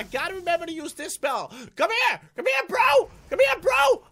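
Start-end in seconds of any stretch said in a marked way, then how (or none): 1.8-4.0 loud, higher call after a runaway; taunting shout. Real, not acted. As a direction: shout them back to fight. Calling after a fleeing enemy.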